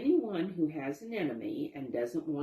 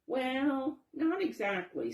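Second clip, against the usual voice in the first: hesitant; 9 semitones higher, 3 dB louder than usual. Acted. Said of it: "said Walter".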